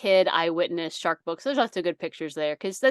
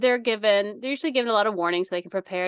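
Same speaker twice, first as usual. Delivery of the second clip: fast reassuring; faster than usual. Retold or imitated. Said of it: "And I said".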